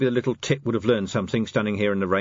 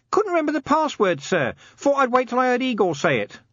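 very high-pitched lowly copper; Nobby; 3 dB louder than usual. Acted. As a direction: explain yourself in a squeaky-high character voice. Nobby explains himself.

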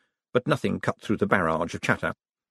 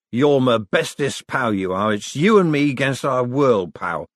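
put-on drunken mumbling; 3 semitones higher, 4 dB louder than usual. A narrator's reading. Falling on someone's neck as his best pal, mumbling. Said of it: "mumbling things like".

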